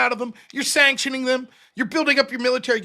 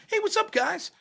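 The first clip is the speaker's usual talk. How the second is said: much higher, breezy friendly greeting; chummy dad; 11 semitones higher, 6 dB quieter than usual. Retold or imitated. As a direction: greet the culprit like an old buddy. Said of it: "he's like".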